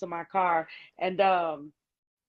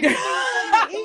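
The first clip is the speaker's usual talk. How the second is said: high, loud laughing shout; fast, laughing, high-pitched mock offer; 14 semitones higher, 9 dB louder, faster than usual. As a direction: blurt it out through laughter.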